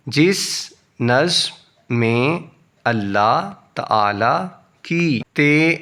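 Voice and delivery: normal talking voice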